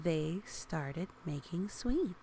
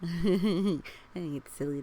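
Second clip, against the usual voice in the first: louder, giggling start; laughing; 6 dB louder than usual. Real, not acted. Reader laughing at the cloud's tongue.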